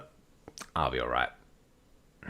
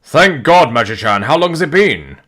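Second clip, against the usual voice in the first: loud, higher joyful greeting; excited; 5 semitones higher, 6 dB louder than usual. Acted. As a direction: call out in happy relief. Character greets rescued creator.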